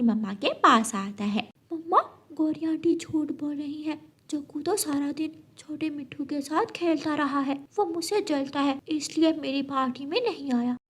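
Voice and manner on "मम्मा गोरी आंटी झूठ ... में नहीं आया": put-on softer, slightly raised child voice; tattling child. Chick telling mother about aunt's lie, hushed complaint. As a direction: confide a complaint like a small child.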